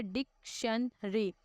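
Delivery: Dictionary said slowly, syllable by syllable; split into syllables. Shown as a demonstration. Teacher to students, showing syllables slowly. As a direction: break dictionary into its parts. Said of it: "ऐसे हम लफ्ज़ों को half half करके बोलते हैं".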